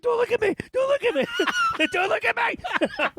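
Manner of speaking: very high, squealed mock panic; panicked plea. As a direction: shriek it in comic, mortified desperation. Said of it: "and sweat and the panic".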